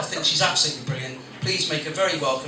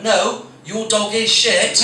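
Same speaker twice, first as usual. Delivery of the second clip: slow, firm correction on "is"; slow deadpan punchline; 5 semitones higher, 7 dB louder, slower than usual. Retold or imitated. Comic to dog owner, slow firm correction on "is". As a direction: deliver it slow and flat, leaning on "is". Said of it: "and went".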